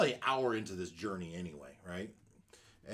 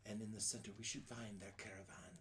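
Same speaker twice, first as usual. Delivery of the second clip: put-on old hag; 10 dB quieter than usual. Hag points out storm.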